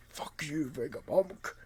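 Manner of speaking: imagined voice of a pissed-off character, understated; lightly voiced angry character. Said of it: "He looks like he'd be talking like".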